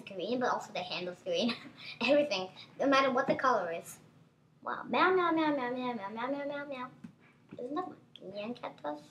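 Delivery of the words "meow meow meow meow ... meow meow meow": rapid string of playful meows; chanted mock meowing; cat meowing. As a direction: meow over and over like a cat. Imitating a meowing cat in game.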